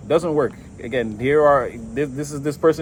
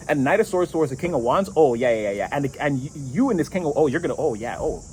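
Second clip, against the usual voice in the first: ordinary talk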